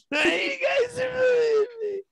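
high, laughing mock wail; mock whining. Host complaining to teasing friends, mock whining. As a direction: play the picked-on victim, voice shooting up.

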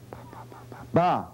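whispered ba. Shown as a demonstration. Therapist modeling a whisper for Dina. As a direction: breathe the syllables with no voice. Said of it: "I want to hear you whisper".

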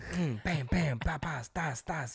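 rhythmic mouth-made smacks and pops; punchy little syllables, one per hand slap; imitated handshake slaps.

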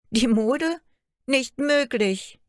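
high, loud, stunned disbelief; shocked cry. Acted. Daughter hears the Mode slandered her, a high shocked cry. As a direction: gasp it out in disbelief. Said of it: "rief Märchen".

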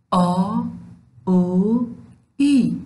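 drawn-out Dari long vowels آ, او, ای; slow teacher vowel drill; long vowel sounds. Shown as a demonstration. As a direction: sound out آ then او then ای clearly. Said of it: "سه حرف صدادار بلند".

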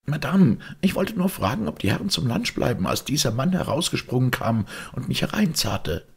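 high-pitched maid making excuses; indignant maid. Acted. Maid explains herself to her mistress, indignant and high. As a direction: protest to your mistress in a high voice. Voices the maid caught listening.

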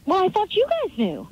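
normal talking voice

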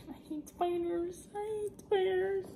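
high, small scared whine. Real, not acted. Vlogger telling viewers she hates spiders, scared whimper. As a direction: whimper it softly, high-pitched. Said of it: "I'm scared".

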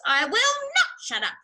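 high, loud indignant shriek. A narrator's reading. Rat refusing Mister Fox's order, shrieking. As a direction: shriek back in outraged refusal. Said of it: "shrieked Rat".

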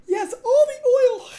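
slow, very high squealing voice. Pretend. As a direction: stretch it out in a squeal.

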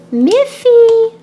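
calling out. Acted. Mother calling child awake.

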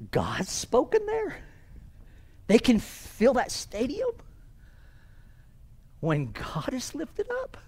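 imitating a hushed, hopeful questioner; awed excitement. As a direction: ask softly, as if hardly daring to believe it. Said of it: "He's so excited".

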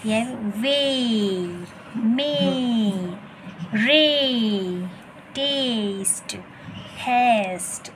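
वे stretched out slowly, then मे, रे, टेस्ट, हैस्ट called clearly; correct pronunciation. Shown as a demonstration. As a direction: model वे and मे slowly for the class. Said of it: "इस तरह से right pronounce करना है".

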